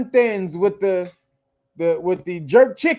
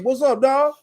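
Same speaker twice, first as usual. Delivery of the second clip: mock hip slang; 5 semitones higher than usual. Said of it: "and then it became".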